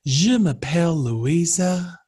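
slow hammed-up French accent; fake French accent. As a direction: giggle through mock French. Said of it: "you would always do it in an accent like".

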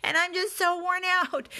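high, loud, frantic breakdown; near-shout of exhaustion and panic; losing it, voice climbing to a desperate cry; overwhelmed outburst. Retold or imitated. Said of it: "I just lost it".

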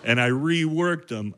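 ordinary talk

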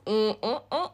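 usual speaking voice

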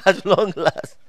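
said through laughter; laughing. Real, not acted. Preacher joking about obituary headings, laughing. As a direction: chuckle your way into the words.

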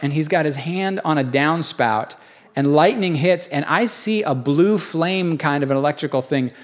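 usual speaking voice

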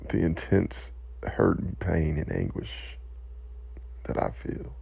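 quiet, choked-up words fading to breath; emotional. Real, not acted. Speaker overcome by grief for victims, quiet and choked. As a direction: hold back tears as the voice gives out. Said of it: "I gotta collect myself".

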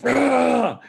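high, loud, held "rah" yell; imitated surfer's whoop; mock battle roar. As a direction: holler it like charging a wave. Imitates surfer's yell riding wave.

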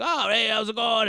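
high-pitched mock stage hello; hearty acted greeting. Comic opening for the Navy crowd, hearty greeting.

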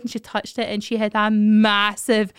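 normal talking voice

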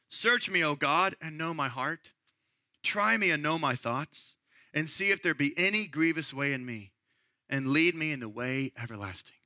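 quiet, reverent psalm reading; psalm recited. Acted. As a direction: read it softly like a prayer.

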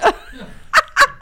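high, loud burst of laughter; loud laughing. Real, not acted. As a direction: crack up at your own joke. Cracks up at own nickname.

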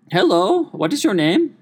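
high mock accented lady; imitating a tech support lady sounding like Gru. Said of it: "she kinda sounded like Gru from Despicable Me".

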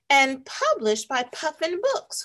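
ordinary delivery, nothing marked

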